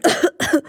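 small acted cough; child's cough. Emil coughs from the fairy dust, small cough.

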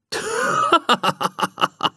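high, hollow ghost laugh from inside a chest; ghostly laugh. A narrator's reading. Ghost laughs at Lilly from the chest, high ghostly laugh. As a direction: let a trapped ghost laugh out. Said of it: "Dröhnt es aus der Truhe".

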